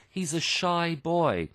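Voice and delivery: normal talking voice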